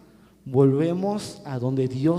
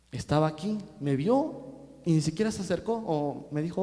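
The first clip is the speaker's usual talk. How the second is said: usual speaking voice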